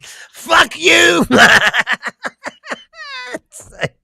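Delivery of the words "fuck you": high, loud movie-thug retort; hammed-up defiant shout; mock defiant yell. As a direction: spit it like the man who won't die. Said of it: "He's like".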